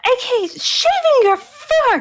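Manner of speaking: high, drawn-out dismay; slow, high-pitched scandalised disbelief; appalled disbelief. Acted. Sister reacts appalled to brother.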